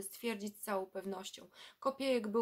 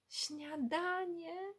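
high, very slow, rising "śniadanie" of a bright idea; the penny drops, he's delighted; sudden inspiration; 8 semitones higher, much slower than usual. Acted. Boy in the waiting room suddenly hits on an idea, high and slow. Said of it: "zawołał nagle olśniony".

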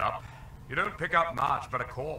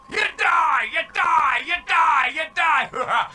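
high, loud repeated yell; shouting; 13 dB louder than usual. Acted. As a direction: scream the verdict at them. Repeated game death announcement.

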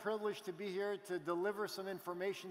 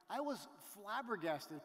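ordinary talk

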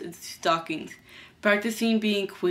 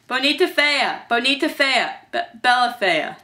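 higher-pitched magical incantation; spell casting; 5 semitones higher, 3 dB louder than usual. Acted. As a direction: chant it like a spell.

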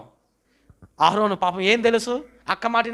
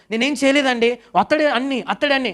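high, whiny excuse; a congregant's whiny excuse; mock excuse; 8 semitones higher than usual. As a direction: shift the blame, pleading innocence.

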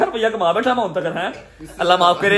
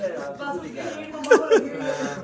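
laughing; 6 semitones higher, 6 dB quieter than usual. Real, not acted. Laughing at the boy running to mom.

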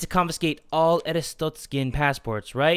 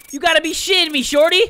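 entrant voice; 11 semitones higher, 5 dB louder, faster than usual. Acted. Voicing entrant in Papers Please.

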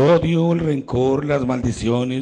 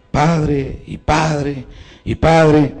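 a retold call, only moderately raised; mock calling out; 7 semitones higher, 6 dB louder, much slower than usual. Girl at night calling for the priest to come, mild mock shout. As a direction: call for the priest through the night, half-acted. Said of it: "Cuando me empiezan a gritar".

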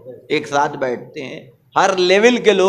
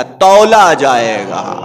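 slow, loud, lower shout; emphatic shout; 4 semitones lower, 8 dB louder, much slower than usual. Real, not acted. Preacher tells the congregation deeds will be weighed, slow shout. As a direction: land the word like a hammer blow. Climax of the sermon point.

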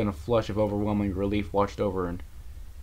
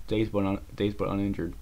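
ordinary delivery, nothing marked

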